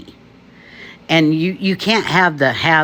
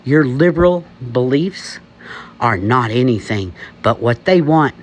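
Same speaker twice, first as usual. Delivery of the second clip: normal talking voice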